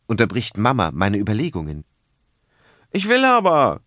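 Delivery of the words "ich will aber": put-on high stubborn child insisting, "will" pushed hard; sulky boy protesting, loud and high; defiant child. A narrator's reading. Boy defies his mother, stubborn and loud. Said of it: "ruft Pepe und ruckelt wütend".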